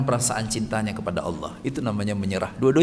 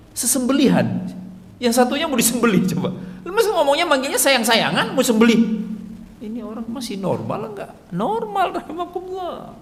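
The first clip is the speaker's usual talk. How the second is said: incredulous; 12 semitones higher than usual. Acted. Mock disbelief at loving slaughter talk.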